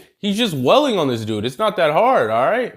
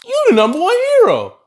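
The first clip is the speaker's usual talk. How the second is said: much higher, louder starstruck gasp; 5 dB louder than usual. Retold or imitated. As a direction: gush at your idol. Fan recognizing All Might.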